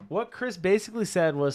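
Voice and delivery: ordinary delivery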